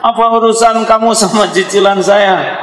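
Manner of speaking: high, laughing indignant woman; mock-huffy comeback through a laugh; offended auntie retort. Offended woman snaps back.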